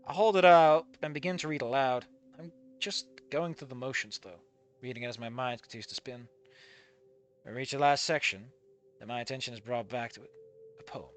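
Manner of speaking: quiet, even reading of the story text; story narration. A narrator's reading. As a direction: read the passage softly, as the narrator. Reads the game's narration.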